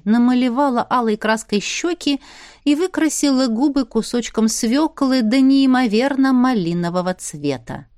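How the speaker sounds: usual speaking voice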